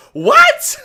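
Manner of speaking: high-pitched squeak of disbelief; incredulous squeak. Real, not acted. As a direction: shoot What? up high and loud in shock. Disbelief, repeated.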